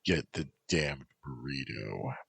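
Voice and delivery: quietly threatening; dangerous edge. A narrator's reading. Amethyst ordering Stephen to fetch the meal, low and menacing. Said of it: "Her voice had a dangerous edge".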